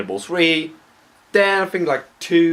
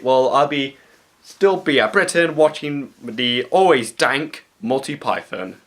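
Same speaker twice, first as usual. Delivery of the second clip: ordinary talk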